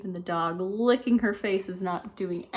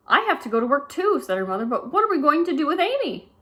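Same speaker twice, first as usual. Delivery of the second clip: worried mother; 7 semitones higher, 4 dB louder than usual. Acted.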